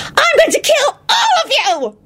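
very high, drawn-out crazed shriek; unhinged high-pitched threat; maniacal squeal. Acted. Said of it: "Loretta squealed maniacally".

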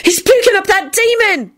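high-pitched alarmed wail. A narrator's reading. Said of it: "Esther wailed".

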